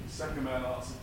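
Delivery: normal talking voice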